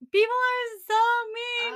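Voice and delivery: high, loud wail that people are so mean; wailing complaint. Retold or imitated. Telling mom on the phone after the store, a loud high wail. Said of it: "I called my mom, and I was just like".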